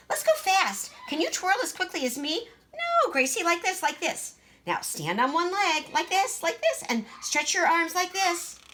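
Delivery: put-on high, bossy little girl voice; Greta, boastful child. Big sister boasting to Gracie, high and bossy. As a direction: show off being taller.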